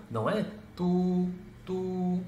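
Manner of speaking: both "tu" held long and high; wrong way, both stretched. Shown as a demonstration. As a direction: stretch each "tu" like the train. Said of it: "igual o de cima". (errado: os dois "tu" esticados)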